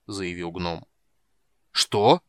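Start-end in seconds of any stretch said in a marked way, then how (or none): 1.7-2.2 a much louder bellowed what; furious loud outburst; roared. A narrator's reading. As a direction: roar it in outrage at the dwarf. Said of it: "взревел Танин".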